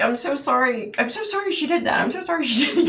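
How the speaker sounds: lightly voiced gentle man apologizing; apologetic little man.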